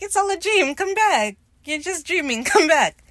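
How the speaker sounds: loud, high coaxing shout, laughing at the end; high loud call. Retold or imitated. Roach calling the runaway back, loud and high. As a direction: call him back, loud and sing-song. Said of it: "And Roach is like".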